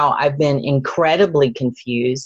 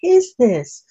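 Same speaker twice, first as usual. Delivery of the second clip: earnest plea, pitched up; pleading question; 6 semitones higher than usual. Retold or imitated. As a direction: ask it high and searching, half-crying. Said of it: "I'm like".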